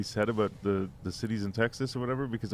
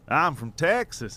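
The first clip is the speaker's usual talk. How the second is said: mock Texas accent, louder, higher and slow; put-on Texas accent; 6 semitones higher, 5 dB louder, slower than usual. Answering where he's from, put-on Texas accent. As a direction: stretch it out in an imitated Texas accent. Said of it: "he starts with a different accent".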